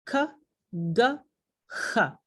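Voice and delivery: k, g, j each isolated, tongue back on the velum; velar consonant demo. Shown as a demonstration. Teacher listing the velar consonants for students, demonstrated. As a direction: sound out k, g, j one by one. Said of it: "la serie de consonantes".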